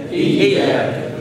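اياك whispered very slowly; whispered demo. Shown as a demonstration. Teacher whispering the word slowly to students. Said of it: "ما تضغطش الايه؟ الياء وتخليها جيم".

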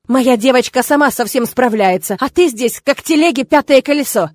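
loud, scornful paternal outburst; indignant father, high and forceful; angry bark. A narrator's reading. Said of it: "рявкнул он на него".

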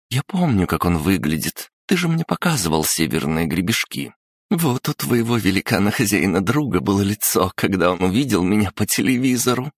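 put-on high, bright girl, quickening at the end; a princess recalling with glee; young girl voice. Rita, young princess, speaks.

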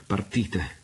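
plain reading voice

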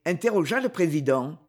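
ordinary delivery

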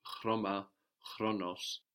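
"chroma, chronos" slowly, with hard Greek ch; hard, throaty ch before the r in "chroma, chronos". Shown as a demonstration. Teacher giving examples before a consonant, hard ch in "chroma, chronos". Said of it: "also if it's followed by a consonant".

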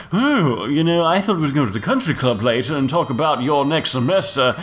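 a hint of a sweet preppy chatter; mock dream girl. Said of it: "not like".

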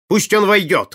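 loud, high command; shouted order. A narrator's reading. Prince orders the visitor let in, shouting. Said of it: "крикнул принц".

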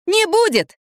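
very high squeal of denial; defiant. A narrator's reading. Girl contradicting the mother a second time, defiant. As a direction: pitch it right up, stubbornly.

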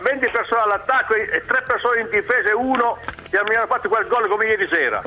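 usual speaking voice

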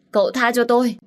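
cậu tha cho tôi cried out high and loud; wailing plea. Acted. Old servant begs the young master, wailing loudly. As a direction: wail the plea, desperate. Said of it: "gần như khóc không ra tiếng".

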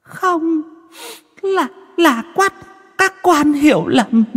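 possessed woman still arguing with the officials; ghostly protest. Acted. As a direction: stammer out the excuse. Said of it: "vẫn còn cố cãi".

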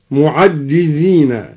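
slowly, معجزين as "mu'ajjizīna"; doubled jim. Shown as a demonstration. Teacher showing al-Duri's form, معجزين with doubled jim. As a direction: lean on a doubled ج in معجزين, no long a. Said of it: "بحذف الألف وتشديد الجيم".

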